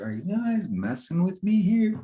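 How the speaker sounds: pitched well up in disbelief; can't believe what he's hearing; mock baffled teen; incredulous. Retold disbelieving reaction.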